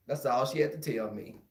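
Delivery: ordinary delivery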